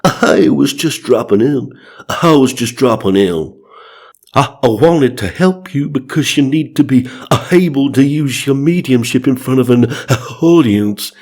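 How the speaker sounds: hiccuping singer impression. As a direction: catch the voice with hiccups as if mid-song. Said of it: "Bunny said".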